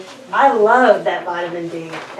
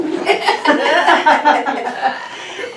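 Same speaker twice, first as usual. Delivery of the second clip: real amused laughter; a hearty laugh; 5 semitones higher than usual. Real, not acted. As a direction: laugh at the joke. Laughs at bedtime joke.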